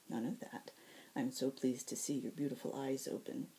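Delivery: soft, hushed and fond; tender. Acted. Ethne comforting the wounded Zack, soft and tender.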